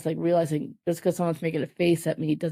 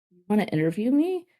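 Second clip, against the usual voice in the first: higher, disbelieving question with a steep rise; incredulous; 4 semitones higher than usual. Retold or imitated. Applicant retelling a call about an interview, incredulous. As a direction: act out your astonishment at the offer.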